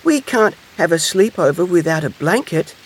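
high-pitched, plaintive little hedgehog; sniffling upset. A narrator's reading. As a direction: lament a spoiled plan. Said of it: "sniffed Little Hedgehog".

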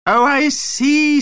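very high, drawn-out vampire voice; dawning vampire realisation. A narrator's reading. Vampire learns about jet lag, realisation. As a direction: stretch it slowly, way up high.

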